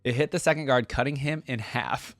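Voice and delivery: steady storyteller read of legend lore; story narration. Acted. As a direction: read it like an epic tale to viewers. Reading the short story aloud.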